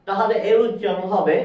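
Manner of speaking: ordinary talk, nothing marked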